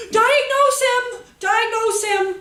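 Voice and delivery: high, loud, urgent cries; hammed-up alarm, high and loud; mock frantic shouting. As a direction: shout it like a panicked crowd of adults. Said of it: "everybody's like".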